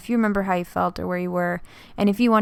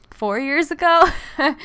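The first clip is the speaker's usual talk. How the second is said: higher, louder, breaking into a laugh; 7 semitones higher, 4 dB louder than usual. Real, not acted. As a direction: let an unexplained laugh take over at the end. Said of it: "don't know why I'm laughing".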